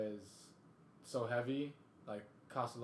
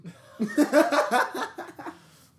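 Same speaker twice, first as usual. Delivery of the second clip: loud, high burst of laughter; 17 dB louder than usual. Real, not acted.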